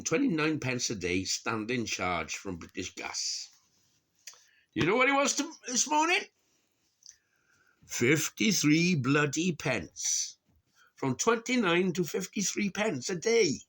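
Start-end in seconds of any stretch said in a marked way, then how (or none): 4.7-6.2 high, loud indignation; outraged disbelief. Real, not acted. Telling viewers about the gas price jump, outraged. 7.9-10.4 "Fifty-three bloody pence" slow, each word weighted; stress on fifty-three. Real, not acted. Spelling out the new gas charge, slowly stressed. 11.0-13.6 high, loud indignation; outraged disbelief. Real, not acted. Telling viewers about the gas price jump, outraged.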